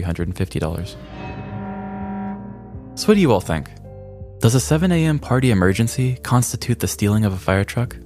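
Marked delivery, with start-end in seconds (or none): none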